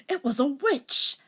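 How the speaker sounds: high excited exclamation; excited cry. A narrator's reading. Reader voicing Rachel, high and excited. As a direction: blurt it out high and loud.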